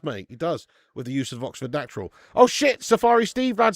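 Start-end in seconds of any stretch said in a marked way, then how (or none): 2.3-3.7 excited yelp. Real, not acted. Reacting to a surprise super chat.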